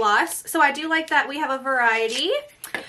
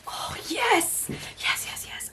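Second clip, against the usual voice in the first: excited whisper; 8 semitones higher, 4 dB quieter, slower than usual. Real, not acted. Guessing the next item.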